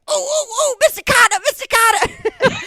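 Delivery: high, loud, eager pleading squeal; Horseshack. Retold or imitated. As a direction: beg to be called on like an overeager schoolkid. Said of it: "I'm like Horseshack in the middle of".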